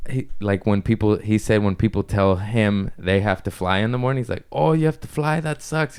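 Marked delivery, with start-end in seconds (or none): 4.5-6.0 German accent attempt. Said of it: "I don't know how to do accents, but I'm gonna try".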